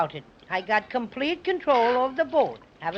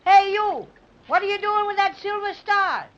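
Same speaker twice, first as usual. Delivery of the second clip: high, raised challenge; yelling; 6 semitones higher than usual. Acted. Bear to policeman about Silver Star, yelling. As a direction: call him out from afar. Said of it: "let me do the yelling".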